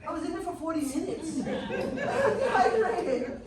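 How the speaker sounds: laughing slow stretched I'm dehydrated; laughing retort. Retold or imitated. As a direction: laugh it off as obvious. Retold answer, laughing.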